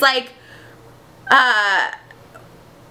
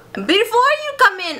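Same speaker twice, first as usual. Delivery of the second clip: high, wobbly attempt at German accent; botched German accent; 10 semitones higher than usual. Playwright stopping visitors, botched German accent. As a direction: swoop high in a badly faked German accent. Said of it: "my accents".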